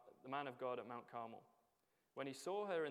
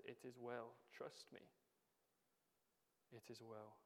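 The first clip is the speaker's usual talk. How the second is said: much softer, hushed comfort, as if holding someone in your arms; tender reassurance; 3 semitones lower, 11 dB quieter than usual. Acted. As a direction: soothe a hurting child, quietly. Jesus comforting, picking us up.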